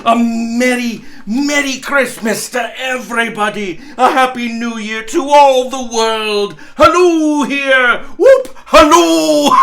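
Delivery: hollering greetings to the whole world; joyful shout. Acted. Scrooge hailing all the world, whooping with joy.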